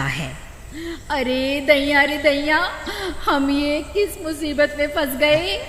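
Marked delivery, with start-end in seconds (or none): 1.1-5.6 high woman's voice, alarmed; worried wife. Acted. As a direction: urge her husband to return the ring. Wife character voice.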